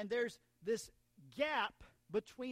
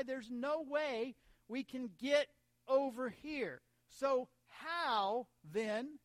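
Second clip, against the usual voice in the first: raised, insistent pulpit voice; emphatic preaching; 5 semitones higher, 5 dB louder, slower than usual. Real, not acted. Preacher telling church of separation from God, emphatic preaching. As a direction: press the problem home with heavy stresses.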